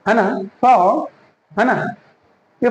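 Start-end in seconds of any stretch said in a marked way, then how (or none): 0.6-1.0 फाओ with L turned into an "o" glide; drawn-out फाओ, tongue never landing on L; dark L demo. Shown as a demonstration. Teacher models the correct word, dark L demo. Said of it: "बल्कि फाओ".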